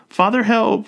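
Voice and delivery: high, loud squealed plea; squealing cry for help. A narrator's reading. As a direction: cry out, half laughing. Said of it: "she cries".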